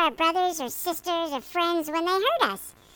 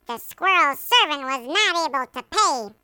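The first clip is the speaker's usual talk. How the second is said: ordinary talk, nothing marked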